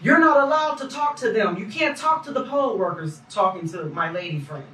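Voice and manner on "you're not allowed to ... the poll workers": raised, higher mock holler; calling out sharply to scold them; retold hollering. Poll worker hollering at them not to talk. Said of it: "came hollering out the back".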